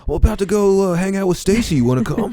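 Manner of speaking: high-pitched dude-bro voice. Acted. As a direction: play the clueless chill friend. Friend acted in movie scene.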